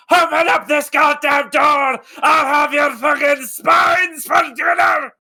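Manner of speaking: high, loud enraged yell through a door; furious shout. Acted. Angry man outside the door threatening the crew, furious yell. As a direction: scream threats at the people inside. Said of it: "you hear a man go".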